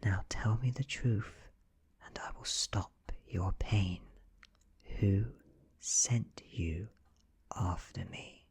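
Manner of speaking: hushed, half-whispered demand; whisper in ear. A narrator's reading. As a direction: coax it out close to the ear. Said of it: "whisper in his ear".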